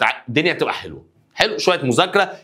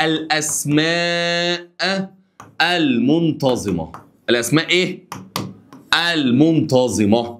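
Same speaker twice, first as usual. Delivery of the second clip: slow emphatic term; 2 semitones higher, much slower than usual. Shown as a demonstration. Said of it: "teacher drills the term".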